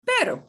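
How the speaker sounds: "pero" with one light tap on r; "pero", r tapped once; single flap. Shown as a demonstration. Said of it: "a single R in the word for".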